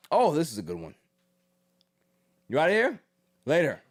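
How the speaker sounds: ordinary talk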